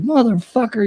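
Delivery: high pitched furious curse; mock rage at the friend in the back seat; angry yell. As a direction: swing round and yell it. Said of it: "I'm like".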